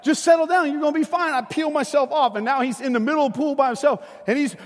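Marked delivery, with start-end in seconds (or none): 0.0-1.3 put-on raised, higher voice over a crying child; retold firm "settle down" from dad; dad insisting. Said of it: "I was like".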